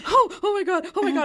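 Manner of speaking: high, overwhelmed oh my God; stunned gasp. Retold or imitated. Retelling her shock on the flight, high and overwhelmed.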